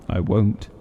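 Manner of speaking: plain reading voice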